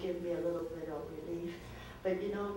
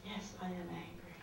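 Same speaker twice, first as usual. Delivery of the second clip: soft, almost whispered; quiet admission; 16 dB quieter, faster than usual. Acted. Worn-out wife quietly admitting her anger to the counsellor. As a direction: let it fall away to nothing.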